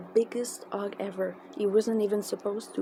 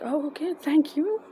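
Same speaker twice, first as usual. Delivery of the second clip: higher, lilting retold thank-you; lifted voice, grateful for a kindness; touched thanks; 8 semitones higher, 4 dB louder than usual. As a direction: act out a sweetly surprised thanks. Acting out her thanks for the food.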